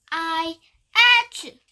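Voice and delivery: starts a word, then a loud sneeze; sneeze mid-word. Acted. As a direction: break off into a big sneeze.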